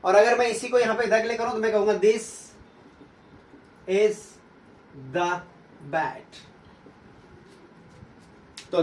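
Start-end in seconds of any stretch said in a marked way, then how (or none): 1.9-6.3 "the" said duh before bat, words split by pauses. Shown as a demonstration. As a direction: separate words, short duh. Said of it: "पढ़ा गया द".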